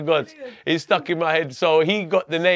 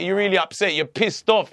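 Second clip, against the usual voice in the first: ordinary delivery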